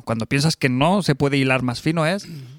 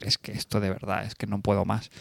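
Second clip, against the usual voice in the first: quieter, worn-out grumble; exasperated mutter; 5 semitones lower, 8 dB quieter than usual. Retold or imitated. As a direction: mutter it like you've had enough. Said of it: "te acabas riendo de decir". (murmullo harto y más bajo)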